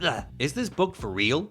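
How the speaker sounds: usual speaking voice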